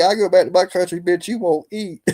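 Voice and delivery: amused imitation of someone going off; retold telling-off. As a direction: act out a comeback for laughs.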